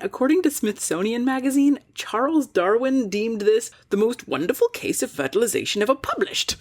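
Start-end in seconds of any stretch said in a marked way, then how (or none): 1.9-6.5 a lightly put-on old British accent, pitched up at the end; Charles Darwin accent. Host reading the Darwin quote, lightly mock British accent. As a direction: quote the great naturalist in a mild mock English accent. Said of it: "I don't know why that's his accent".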